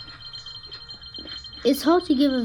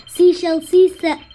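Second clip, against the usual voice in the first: loud, high, rapid repetition; fast tongue twister; 6 semitones higher, 10 dB louder than usual. Shown as a demonstration. Racing through the tongue-twister game, fast and loud. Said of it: "You need to say it really fast".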